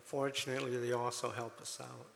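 ordinary talk, nothing marked

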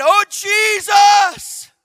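crying out. Retold or imitated. Man after a seizure calling on Jesus, drawn-out cry. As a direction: cry out the name slowly, at full voice. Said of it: "I just cried".

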